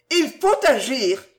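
high, loud desperate cry; mother half mad with grief for her son. Acted. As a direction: plead frantically for action. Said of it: "à demi folle de douleur".

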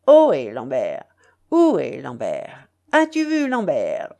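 loud call, each phrase swooping down; parting cry. A narrator's reading. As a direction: call out loudly with falling cadence. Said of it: "cria-t-il pour la dernière fois".

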